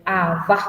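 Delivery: aba slowly as a-BA, final A stressed; drawn-out a-BA, stressed at the end; stress on last syllable. Shown as a demonstration. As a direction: put the weight on the last A of aba. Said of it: "Sin acento inicial".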